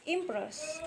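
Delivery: "impress" softly set out as a stress example; stress demonstration. Shown as a demonstration. Teacher showing stress in an English verb, "impress" said deliberately.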